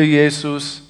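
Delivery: ordinary talk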